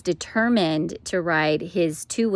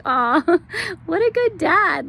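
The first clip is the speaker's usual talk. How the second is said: high, laughing "aw" of delight, a bit louder; 11 semitones higher, 4 dB louder than usual. Retold or imitated. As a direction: gush with a laugh over the good dad. Touched by husband's fatherly care.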